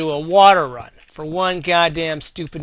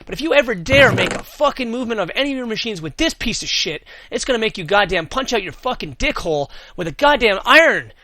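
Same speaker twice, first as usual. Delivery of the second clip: building furious rant, loud and high; escalating comedy rant; 7 semitones higher, 5 dB louder than usual. Real, not acted. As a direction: work up into a furious yelling rant. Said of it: "share how frustratingly annoyed I was".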